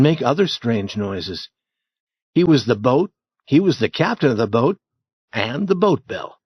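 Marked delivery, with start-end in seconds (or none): none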